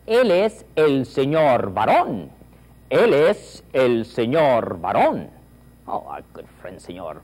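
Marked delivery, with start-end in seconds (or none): 0.1-5.3 slow, louder, rolling varón; big enthusiastic classroom drill. Shown as a demonstration. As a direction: savour every sound with gusto. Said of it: "you must say Spanish with enthusiasm".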